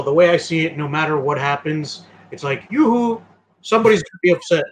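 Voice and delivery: ordinary delivery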